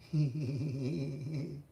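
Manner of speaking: thin, drawn-out creepy giggle; wavering high snicker, put on; imitated sinister laugh. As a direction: mimic a killer's eerie tittering laugh. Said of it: "he went".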